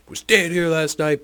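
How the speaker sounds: high, loud, putting on the dad's voice; voiced dad. Father questioning the boys about his son, high loud voice. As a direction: press them for answers like the stern dad.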